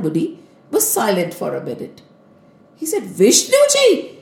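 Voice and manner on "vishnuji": high, astonished echo of the name; incredulous. Acted. Narad Muni is told not to tell Vishnuji, incredulous. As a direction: question the name as if it were absurd.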